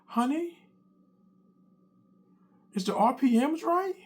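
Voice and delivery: high questioning wife voice; suspicious wife. Retold or imitated. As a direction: ask innocently but pointed. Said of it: "She said".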